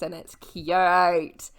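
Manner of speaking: gushing. Real, not acted. Gushing about the dance.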